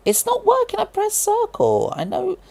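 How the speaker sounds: very high, squeaky exasperated voice; high squeaky frustration. Real, not acted. Frustrated squeak at game controls.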